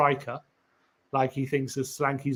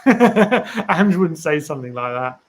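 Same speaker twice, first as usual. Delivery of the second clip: laughing; 6 dB louder, slower than usual. Real, not acted. Laughing off his own theory.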